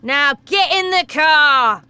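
father ordering his daughter; stern order. Acted. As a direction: order her in, no arguing.

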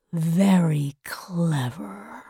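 low, slow, soft villain voice; sly villain. Acted. The voice answering the boy's challenge, low sly villain.

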